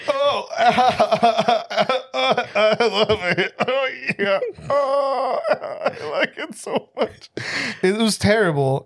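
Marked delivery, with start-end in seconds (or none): 0.1-7.3 high, laughing fake moans that turn crying; imitating sex sounds through laughter, much higher; mock moaning. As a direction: ham up her noises while cracking up. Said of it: "it sounded like she was crying".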